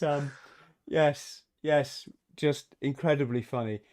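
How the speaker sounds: ordinary talk, nothing marked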